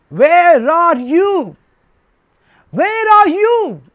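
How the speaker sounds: loud, high, drawn-out call; God calling out. Acted. God searching for man hiding in the garden, calling out. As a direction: call out slowly for someone hidden. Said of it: "giving a voice, or before he saw man".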